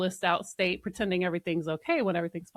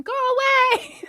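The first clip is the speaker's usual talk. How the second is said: high mock yell of children; imitated scream; 8 dB louder, faster than usual. Children to parent waking them up, mock scream. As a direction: yelp it like woken kids, in jest. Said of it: "and they scream".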